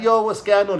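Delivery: loud, high hello across the wing; excited greeting. Retold or imitated. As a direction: greet your boy like you're glad to see him. Greeting his old friend in jail.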